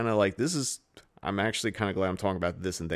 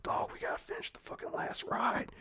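whisper; 8 dB quieter than usual. Retold or imitated. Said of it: "we're like".

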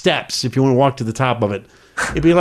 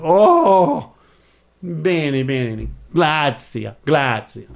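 playing the humble Italian local; fake Italian accent; much slower than usual. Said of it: "I pretend I was a Italian".

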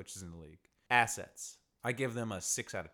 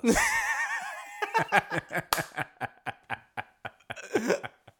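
big laugh; 5 dB louder than usual. Real, not acted. Laughing at own punchline.

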